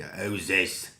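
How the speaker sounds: curt Australian "Who's this?", loud; angry Australian accent. Retold or imitated. A mum answering a kid's call in a loud, angry Australian accent. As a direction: snap it like a hostile Australian mum. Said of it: "thick, very angry, uh, Australian accent".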